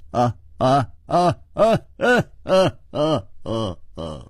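booming theatrical "ha ha" laugh, rising then fading; kingly belly laugh, hammed up; big acted laugh. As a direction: laugh heartily in separate bursts. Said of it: "秋瓷王已经大笑了".

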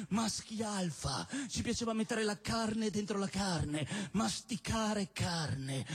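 slow macho boast. Acted.

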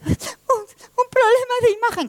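high, naive echo; bewildered innocence. Acted. Truth repeating the Lie's diagnosis, high bewildered echo. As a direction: repeat it like a puzzled child.

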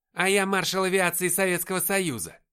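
loud, high sarcastic retort; mock the prisoner's claim loudly; громкая издёвка. A narrator's reading. Сержант издевается над пленным. (насмешливый крик сержанта)

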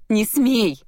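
high, loud burst of anger; boiling over. A narrator's reading. Heroine cutting off his offer, boiling over. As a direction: explode in indignation. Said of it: "вскипела я".

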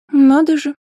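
high-pitched wonder; surprised. A narrator's reading. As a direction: react with lifted amazement. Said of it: "удивилась Анфиса".

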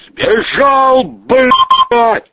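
high, loud cry with a drawn-out curse; shouting. Acted. Man outside the door reports an escape, shouting. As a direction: shout the alarm through a door. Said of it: "крикнули под дверью".

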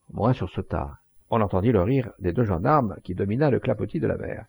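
plain reading voice

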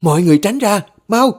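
high, loud warning shout; urgent shout. Acted. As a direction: yell at them to clear away. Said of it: "Bà hét lên". (hét lên gấp gáp)

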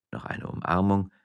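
plain reading voice

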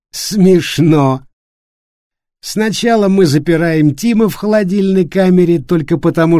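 slurred bitter sarcasm; bitter drunken rant. A narrator's reading. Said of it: "Флориан истерически хихикнул". (пьяная горькая злость)

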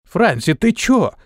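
high, heated outburst; hot-tempered cry at Fransi. A narrator's reading. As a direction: fling it at her hotly. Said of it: "запальчиво прокричал Антон".

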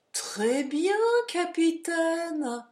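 very slow, high, loud knowing tone; knowing wink. Acted. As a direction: say it with a sly wink. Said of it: "avec un clignement d'œil".

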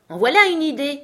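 high, loud indignant exclamation; loud protest. A narrator's reading. Alice scoffs at the queen's ruling, loudly protesting. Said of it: "protesta Alice d'une voix forte".

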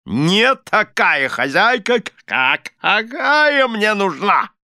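high, slow, blustering reproach; indignant exclamation. A narrator's reading. Husband telling wife she falls short, indignant. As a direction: pronounce the verdict loftily, dragging the words. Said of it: "воскликнул мистер Корнер".

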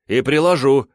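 loud defiant snap; defiant shout. A narrator's reading. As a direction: throw it back defiantly. Defiant retort.

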